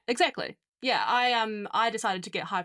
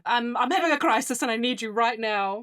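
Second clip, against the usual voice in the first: louder, higher, urgent panic; panicked plea; 5 semitones higher, 6 dB louder than usual. Retold or imitated. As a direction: beg your best friend for help in a rush. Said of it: "messaged my best friend".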